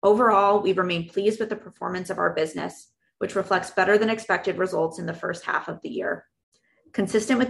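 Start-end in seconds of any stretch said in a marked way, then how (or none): none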